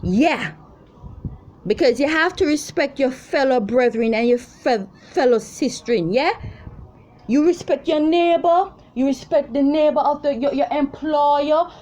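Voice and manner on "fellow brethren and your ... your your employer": raised lecturing. Real, not acted. Preaching respect, voice raised.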